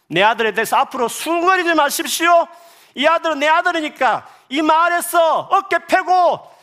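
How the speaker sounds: father proclaiming. Acted. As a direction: command the village to honor the boy. Father addresses whole village. (마을 사람들 앞에서 높은 목소리로 선포하는 아버지)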